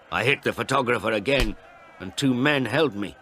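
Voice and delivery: ordinary delivery, nothing marked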